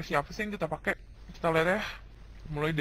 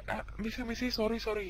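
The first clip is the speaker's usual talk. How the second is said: quick sorry; 5 semitones higher, 5 dB quieter than usual. Retold or imitated. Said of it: "Kayak yang cepet gitu".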